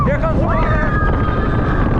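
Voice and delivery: high, loud and drawn out; bracing for the splash; excited. Real, not acted. Coaster plunging toward the splash.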